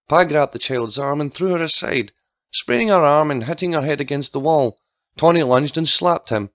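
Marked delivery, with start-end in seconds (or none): none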